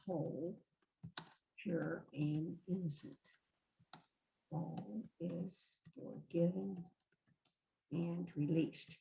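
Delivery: slow, very soft, spacious "you are spirit"; soft blessing. Shown as a demonstration. Leader demonstrating the forgiveness phrase to the group, slow soft blessing. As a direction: bless the person gently, letting each phrase hang. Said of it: "I'm gonna say all of it".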